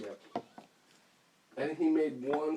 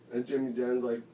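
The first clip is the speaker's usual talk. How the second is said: ordinary delivery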